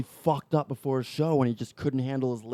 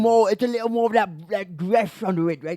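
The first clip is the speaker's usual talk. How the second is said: Cockney accent; 4 semitones higher than usual. Shown as a demonstration. Host showing how Cockney goes, broad Cockney accent. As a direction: put on a rough Cockney accent. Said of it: "you got the Cockney pretty good".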